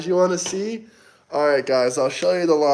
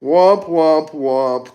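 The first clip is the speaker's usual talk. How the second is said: mock sad trombone; 4 dB louder, much slower than usual.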